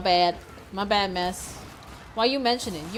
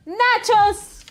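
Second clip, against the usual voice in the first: loud, higher shout of a name; yelling at teammate; 9 semitones higher, 8 dB louder, much slower than usual. Real, not acted. Streamer yelling at teammate Nachos to stop, loud shout. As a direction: call out sharply to stop him.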